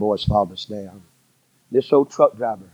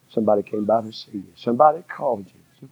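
ordinary delivery

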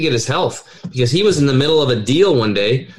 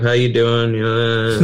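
depressed voice; 2 semitones lower, slower than usual. Pretend. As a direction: sound slumped and defeated. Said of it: "they talk like this".

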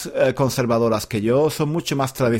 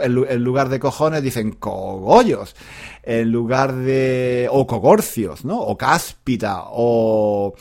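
ordinary talk

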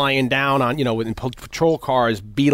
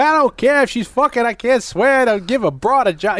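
mock swearing rant; 10 semitones higher than usual. Boss swearing about the new hire, higher mock anger. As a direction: curse like a fed-up boss.

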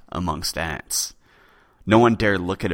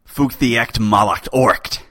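curse incantation; 4 semitones higher, 7 dB louder, slower than usual. Acted. Poet begins a curse incantation.

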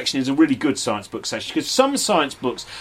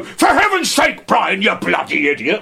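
much higher, loud, slow hammed-up bellow; Brian Blessed impersonation; 5 semitones higher, 4 dB louder than usual. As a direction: roar it out like a theatrical old ham. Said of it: "do a Brian Blessed impersonation".

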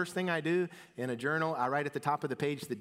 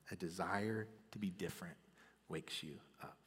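softer, lower whispered repeat; whispered sermon line; 6 semitones lower, 11 dB quieter than usual. Acted. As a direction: breathe it as a hushed echo.

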